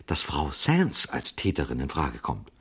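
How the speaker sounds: ordinary delivery, nothing marked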